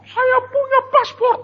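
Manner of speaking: imitated high, loud indignant protest; mock tantrum at the top of the voice; angry ranting. Mother raging at vendors who cannot understand, angry ranting. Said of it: "Ngamuk-ngamuk".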